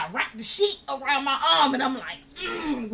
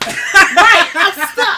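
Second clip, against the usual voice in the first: very loud, high agreement; indignant exclamation; 6 semitones higher, 14 dB louder, much slower than usual. Real, not acted. Storyteller to listener on being left behind, loud comic indignation. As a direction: burst out at the absurdity.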